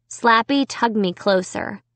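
usual speaking voice

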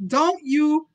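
put-on high, loud child's voice; small boy speaking. A narrator's reading. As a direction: push the words out like a defiant little boy. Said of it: "his little chin jumped out as he said".

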